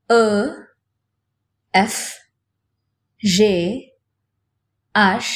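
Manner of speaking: letters said one by one the French way; French letter names, spaced out; French alphabet. Shown as a demonstration. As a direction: recite each letter slowly, with pauses. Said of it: "আর একবার শুনে নাও".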